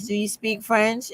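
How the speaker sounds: ordinary talk, nothing marked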